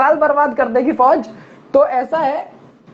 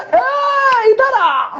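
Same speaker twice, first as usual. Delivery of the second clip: instructor's bark; 11 semitones higher, much slower than usual. Retold or imitated. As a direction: bark the order at the cadets. Instructor catches the cadets. (Drill उस्ताद की ऊँची डांट)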